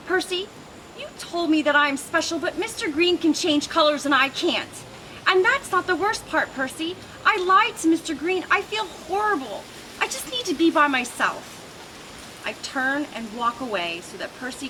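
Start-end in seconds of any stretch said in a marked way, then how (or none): 0.1-11.4 higher, lightly voiced distress; a small lizard holding back tears; a hint of tearful pleading; upset, near tears. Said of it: "because I might cry".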